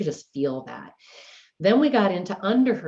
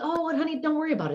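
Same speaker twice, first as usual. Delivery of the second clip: a dad's breezy reassurance, pitched much higher; reassuring dad; 13 semitones higher, much faster than usual. Retold or imitated. Dad telling daughter not to come clean, breezy reassurance. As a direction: brush off the worry lightly, like a doting father.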